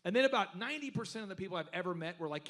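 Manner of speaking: usual speaking voice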